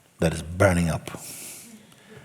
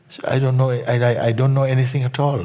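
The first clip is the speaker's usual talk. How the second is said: louder, higher, a touch faster; joyful outburst; 6 semitones higher, 5 dB louder than usual. Retold or imitated. Student exclaims to the teacher that he knows nothing, joyful. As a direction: burst out with delighted disbelief.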